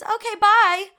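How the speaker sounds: high and loud, a chirpy brush-off; curt nurse. Retold or imitated. Mocking the nurse taking the bag, high and chirpy. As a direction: dismiss her quickly and brightly. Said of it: "and says".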